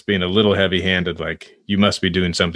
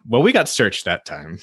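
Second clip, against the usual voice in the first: ordinary delivery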